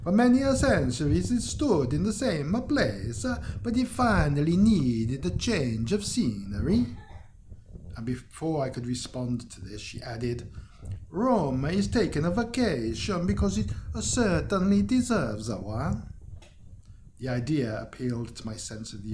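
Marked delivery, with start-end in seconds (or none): 0.0-6.9 put-on a light, higher Italian accent for the local woman. Local woman tells the traveller Rome vanished, light Italian accent. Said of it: "She should be Italian". 11.1-16.1 put-on a light, higher Italian accent for the local woman. Local woman tells the traveller Rome vanished, light Italian accent. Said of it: "She should be Italian".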